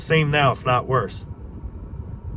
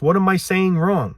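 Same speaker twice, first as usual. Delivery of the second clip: higher-pitched, insistent questioning; exasperated protest; 6 semitones higher than usual. Real, not acted. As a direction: challenge doubters with raised pitch, really wanting an answer. Exasperated appeal to critics.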